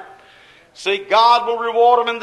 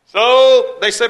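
loud preaching; 2 semitones higher, 10 dB louder, slower than usual. Real, not acted. Preacher at full force.

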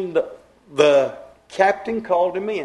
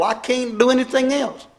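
fervent cry; 6 semitones higher, 3 dB louder than usual. Real, not acted. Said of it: "It's like fire shut up in my bones".

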